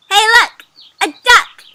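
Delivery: high, loud excited call; excited spotting. Acted. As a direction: point out a thrilling discovery, loudly. First character spots a duck.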